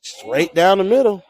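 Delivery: loud, rising call with a lift in pitch; excited play-by-play. Real, not acted. Announcer calling the pitcher's first strike, excited.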